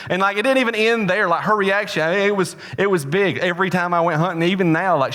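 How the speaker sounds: ordinary talk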